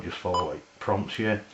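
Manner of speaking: ordinary talk, nothing marked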